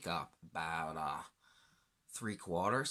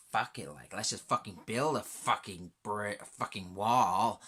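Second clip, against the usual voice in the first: normal talking voice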